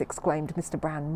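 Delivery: ordinary delivery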